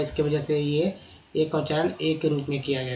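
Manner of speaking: ordinary delivery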